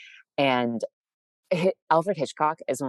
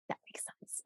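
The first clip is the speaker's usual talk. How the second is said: very soft, voiceless aside; mock whisper; 19 dB quieter than usual. People look at her dancing and get it, whispering. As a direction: imitate an onlooker murmuring in realization.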